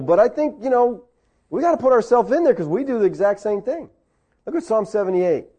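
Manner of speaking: ordinary talk, nothing marked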